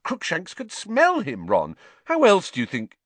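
high, exasperated arguing; heated, impatient reasoning. A narrator's reading. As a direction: argue impatiently, pitch jumping up. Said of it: "said Hermione impatiently".

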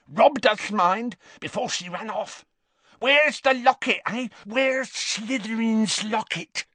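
put-on furious drunk demanding the locket; drunken rage. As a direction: demand the locket in rising fury. Morphin works into rage.

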